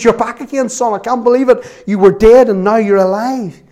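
high, loud cry of welcome; joyful retold shout. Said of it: "he's shouting at him".

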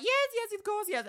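eager yes. Retold or imitated. Manager eagerly answering head office. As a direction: gush agreement to head office. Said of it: "My manager was like".

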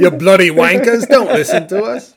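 mock Cockney insult; joking Cockney accent. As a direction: scold the audience in put-on Cockney. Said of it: "whatever your Cockney crushing".

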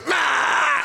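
high loud goat bleat; mocking goat cry. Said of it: "he'd go".

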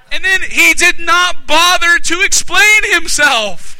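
high, loud, slow declaration; forceful preaching. Real, not acted. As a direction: hammer out every word slowly. Preacher drives the point home.

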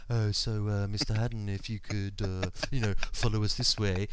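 hushed, overly gentle doctor voice; lightly voiced funeral-home gentleness; soothing doctor. Doctor leading the patient, lightly hushed and gentle. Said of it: "they go".

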